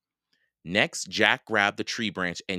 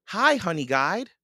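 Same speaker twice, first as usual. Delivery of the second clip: high, louder call to a bird; 11 semitones higher, 5 dB louder than usual. A narrator's reading. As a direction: call out a bright high greeting. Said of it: "Annie called to the bird".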